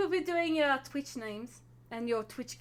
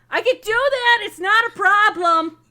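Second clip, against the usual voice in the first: high, very loud shout from the back; loud, high-pitched interjection; Gwen piping up; 11 semitones higher, 17 dB louder than usual. Acted. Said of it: "piping up".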